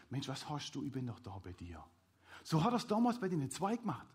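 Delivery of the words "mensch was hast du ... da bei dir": low, very soft comforting voice; quiet, tender comfort; gentle reassurance. Acted. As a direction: drop down soft to reassure a frightened child. Preacher voices Jesus comforting a child.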